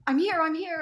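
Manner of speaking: hurried eager arrival; breathless arrival. Retold or imitated. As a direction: burst in announcing yourself. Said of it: "and I'm like".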